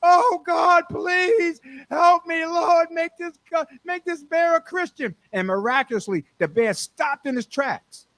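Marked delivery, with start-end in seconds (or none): 0.0-5.2 desperate prayer. Acted. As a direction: beg God at the top of your voice. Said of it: "He started on his knees and said".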